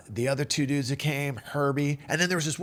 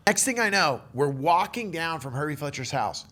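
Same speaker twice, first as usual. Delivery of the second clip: ordinary delivery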